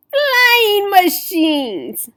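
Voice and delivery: slow, loud and high, full of wonder; drawn-out gasp of delight; excited reveal. A narrator's reading. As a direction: announce it like a marvel. Narrator's big reveal of machines.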